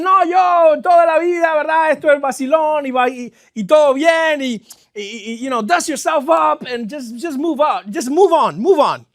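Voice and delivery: loud, mocking voice of people who say men don't cry; half-shouted imitation of dismissive advice; mock tough-guy advice. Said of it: "Está conectada con lo que dicen".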